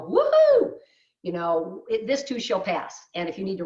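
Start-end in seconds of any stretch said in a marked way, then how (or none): 0.0-0.8 high, loud, drawn-out woohoo; celebratory cheer. Real, not acted. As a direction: whoop in celebration. Cheers relaxing covid restrictions.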